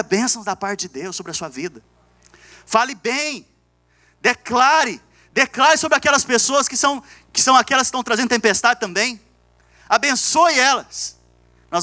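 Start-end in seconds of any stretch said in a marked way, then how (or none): 2.7-11.2 high-pitched, urging sermon voice; exhorting preaching. Real, not acted. Pastor urging the church to bless others, exhorting preaching. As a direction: urge each command on the congregation.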